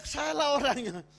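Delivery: high, half-strength yell; mock a sudden cry from the back; re-enacted shout. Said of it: "tiba-tiba berteriak".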